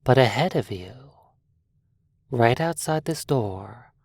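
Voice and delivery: ordinary delivery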